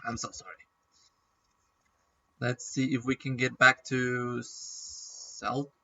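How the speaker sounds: normal talking voice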